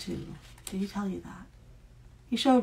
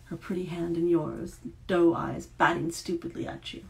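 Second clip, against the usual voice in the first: ordinary delivery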